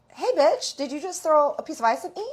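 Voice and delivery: high, loud, quick mock challenge; outraged confrontation. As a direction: imitate an indignant friend calling her out. Acted friend confronting the ice thrower.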